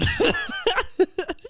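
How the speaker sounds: very high squealing laugh; high burst of laughter; real laugh. Real, not acted. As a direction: crack up. Laughing at own bit.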